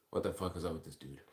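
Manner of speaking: normal talking voice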